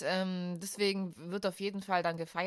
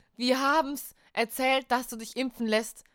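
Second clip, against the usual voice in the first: louder, higher, insistent protest; insisting; 4 semitones higher, 8 dB louder than usual. Real, not acted. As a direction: insist loudly that you're right. Heated podcast argument, insisting.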